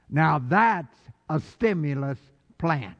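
ordinary delivery

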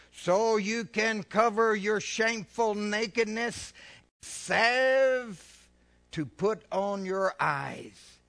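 slow, loud pulpit reading; slow loud preacher reading Revelation; solemn scripture reading. Acted. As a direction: proclaim each phrase slowly. Scripture read.

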